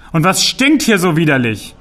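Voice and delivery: loud disgusted troll shout; loud troll complaining of the stink; thundering. Acted. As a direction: bellow your disgust at the smell. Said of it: "donnerte Part im Keller".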